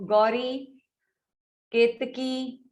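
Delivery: ordinary talk, nothing marked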